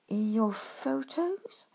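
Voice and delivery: very slow, puzzled repeat; slowly repeated. Acted. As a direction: draw out each word, bemused. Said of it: "he repeated slowly".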